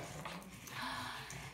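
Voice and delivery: sharp unvoiced gasp; quiet startled gasp. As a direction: suck in air in surprise. Surprise in story.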